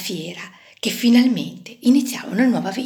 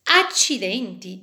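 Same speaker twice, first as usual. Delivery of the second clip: ordinary delivery, nothing marked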